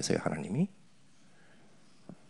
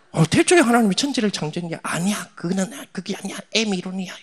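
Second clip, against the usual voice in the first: much higher smug lecturing; mocking atheist; 8 semitones higher, 3 dB louder than usual. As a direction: lecture like a know-it-all skeptic. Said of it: "이러면서 막". (무신론자를 흉내 내며 높은 목소리로 잘난 척)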